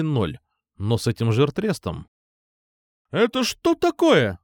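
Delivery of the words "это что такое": strict teacher from the corridor. A narrator's reading. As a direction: call out sternly from the corridor. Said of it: "строгий и громкий женский голос из коридора".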